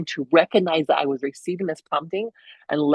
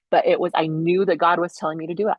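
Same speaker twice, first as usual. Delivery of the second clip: normal talking voice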